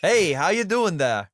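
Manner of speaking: loud, hammed-up Minnesota greeting; Minnesota accent. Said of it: "give me a good Minnesota accent".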